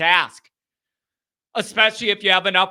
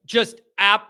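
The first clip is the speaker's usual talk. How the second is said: ordinary talk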